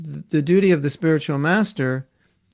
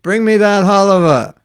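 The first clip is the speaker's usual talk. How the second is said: louder, higher, commanding; 6 semitones higher, 10 dB louder than usual. Retold or imitated. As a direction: call for it with authority.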